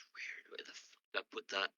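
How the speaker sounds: whispered to himself, searching; under the breath; muttered aside. Real, not acted.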